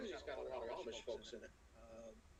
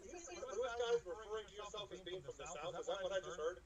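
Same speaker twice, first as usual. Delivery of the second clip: high, mock-offended disbelief; mock indignant; 6 semitones higher than usual. Southerner mocking the Midwesterners, high and indignant. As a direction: pretend outrage at being lumped in.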